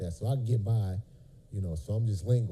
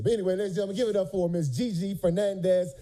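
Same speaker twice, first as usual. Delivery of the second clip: host introduction; 9 semitones higher than usual. Acted. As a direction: hype up the guest like an MC. Hypes the guest's entrance.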